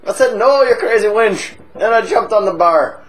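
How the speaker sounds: boozy Irish pub regular, chatty and cheeky; hammed-up Irish drunk telling a tale; stage Irish accent. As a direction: lay on a thick comic Irish brogue. Held Irish voice.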